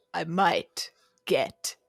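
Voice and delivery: slow, hammed-up menace; imitated crazy-sounding movie line; over-the-top crazy. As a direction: draw it out like an unhinged movie character. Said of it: "it's so crazy sounding".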